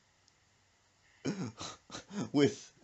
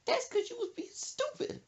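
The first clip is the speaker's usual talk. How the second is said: wailing hurt mama; 4 dB quieter than usual. Retold or imitated. Mother hit in the stomach, high mock-pained wail. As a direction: wail it as if winded by a blow.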